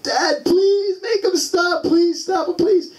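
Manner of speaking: high-pitched imitation of a hysterical crying child; screaming, pleading. Son begging dad as the doctor stitches him, screaming. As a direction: act out a boy begging in a tearful high yell. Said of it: "he's, like, screaming and yelling at me".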